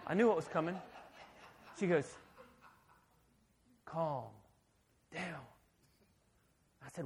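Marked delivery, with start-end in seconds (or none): 3.8-5.4 imitated soothing. Imitating wife calming him.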